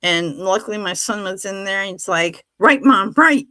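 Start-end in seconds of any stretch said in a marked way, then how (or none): 2.6-3.4 loud, higher call of a son correcting his mom; son's loud correction. Retold or imitated. Son calls out to his mom while she drives, loud and higher. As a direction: shout the direction at mom, half laughing.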